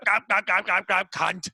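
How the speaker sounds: garbled mock English gangster; unintelligible English accent. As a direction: imitate incomprehensible British speech as clipped gibberish. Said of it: "I could not understand anything they were fucking saying".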